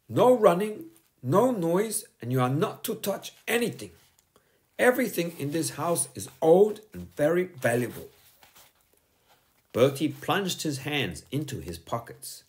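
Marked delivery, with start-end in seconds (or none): 0.1-8.1 high, firm schoolteacher voice; a strict teacher scolding a pupil; stern teacher. A narrator's reading. Teacher warning Bertie to sit down, stern and high. Miss Boot's voice.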